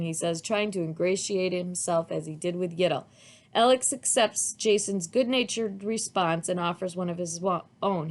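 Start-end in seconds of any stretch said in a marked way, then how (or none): none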